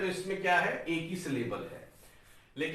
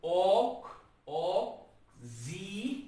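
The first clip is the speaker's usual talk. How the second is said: 'ऑक' and 'जी' as detached syllables; syllable split; 2 semitones higher, much slower than usual. Shown as a demonstration. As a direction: pause after each 'ऑक', then a drawn 'जी'. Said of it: "इसमें दिक्कत है आपको". ('ऑक', 'जी' को अलग-अलग खंडों में)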